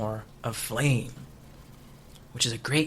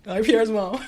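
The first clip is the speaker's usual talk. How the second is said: playful greeting; 8 semitones higher, 6 dB louder than usual. Real, not acted. Greeting his mom on air.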